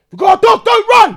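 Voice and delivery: high loud warning shout; officer shouting at suspects not to run; police shout. Retold or imitated. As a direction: yell the warning at fleeing men.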